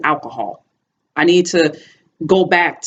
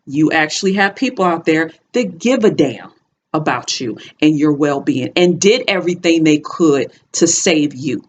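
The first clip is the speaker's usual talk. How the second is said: usual speaking voice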